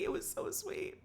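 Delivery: high, whispered gush; swooning whisper. Real, not acted. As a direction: whisper it, melting.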